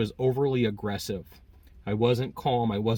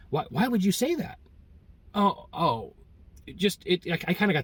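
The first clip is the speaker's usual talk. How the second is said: flustered client; 7 semitones higher than usual. Retold or imitated.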